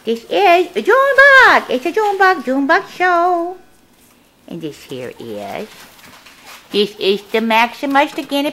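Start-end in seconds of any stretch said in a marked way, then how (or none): 0.0-3.6 high, loud, stretched-out pet talk; excited pet showing. Real, not acted. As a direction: sing out the pet's name to the camera.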